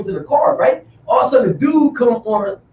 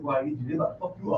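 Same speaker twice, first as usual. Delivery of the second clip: lower, much softer, half-whispered warning; hushed warning; 9 semitones lower, 14 dB quieter, much faster than usual. Retold or imitated. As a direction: lean in and warn him low. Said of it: "He said".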